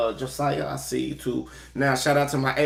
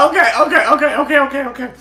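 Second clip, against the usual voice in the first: higher, louder words through laughter; laughing okays; 11 semitones higher, 7 dB louder, faster than usual. Real, not acted. Host recovering from laughter, laughing through okays. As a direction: gasp out the okays still laughing. Catching breath after laughing.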